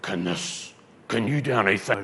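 a retold demon voice from a possessed woman; deep dark voice. Preacher retelling the demon speaking through the woman, dark growl. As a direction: growl it low and dark, like something else inside her. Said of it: "this deep, dark voice came out of her".

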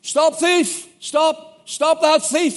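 high, loud alarm call; shouting stop thief. Retold or imitated. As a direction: yell it across the square. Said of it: "I started shouting".

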